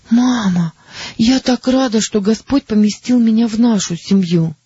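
put-on high, light girl's voice; young girl voice. A narrator's reading. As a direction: speak as a happy young daughter. Judy's character voice.

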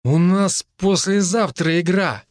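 high, slow, tipsy worry; mildly anxious, drawn-out words; worried, slurred. A narrator's reading. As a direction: drag out the worry like a drunk teammate. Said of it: "обеспокоенный, но чертовски заплетающийся голос".

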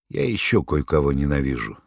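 plain reading voice

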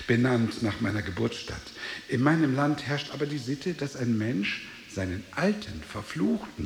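ordinary talk

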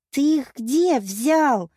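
slow, high, loud demand; hysterical edge. A narrator's reading. Woman questioning the suitor about flowers, ringing hysterical edge. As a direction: stretch each word with rising hysteria. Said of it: "отчётливо зазвенели истерические нотки".

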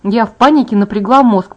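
ordinary delivery

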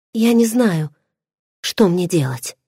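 ordinary delivery

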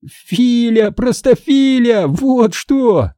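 high, drawn-out teasing cry; gleeful exclamation. Acted. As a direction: crow it slowly at the fooled victim. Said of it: "вскликнул я и расхохотался". (торжествующий дразнящий возглас)

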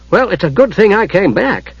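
resolute. A narrator's reading. Kender takes charge of the dwarf, mildly resolute. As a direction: declare it with mild firmness. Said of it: "Taz said resolutely".

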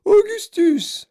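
very high, loud, drawn-out call; piercing motherly yell; mother's shriek. A narrator's reading. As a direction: shriek the name slowly in alarm. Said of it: "cria madame Gloop".

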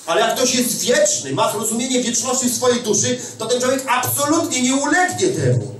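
normal talking voice

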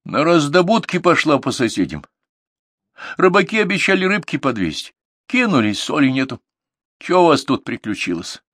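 grandma fussing and chattering; lightly voiced old grandmother. A narrator's reading. Grandmother telling granddaughter the news, lightly voiced granny.

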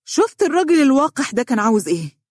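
high-pitched, indignant outburst; agitated anger. Acted. Boss venting to lawyer about brazen client, agitated. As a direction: burst out in outraged disbelief. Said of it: "قال الدكتور حمدي بعصبية".

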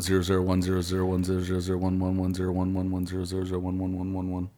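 quiet fast robotic number string; robot saying binary. Pretend. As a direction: rattle off binary like a computer. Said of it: "it was a little binary right there".

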